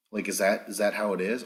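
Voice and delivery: normal talking voice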